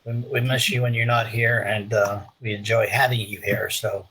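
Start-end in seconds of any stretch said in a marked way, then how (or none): none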